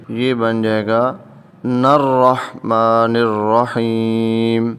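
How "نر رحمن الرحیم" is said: نر رحمن said slowly with the nun merged into the ra; joined reading. Shown as a demonstration. Teacher showing how the verses join, slow recitation. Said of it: "نون کو آگے را سے ساتھ ملائیں گے".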